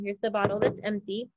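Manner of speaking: usual speaking voice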